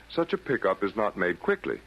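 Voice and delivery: ordinary talk, nothing marked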